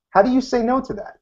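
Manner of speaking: ordinary delivery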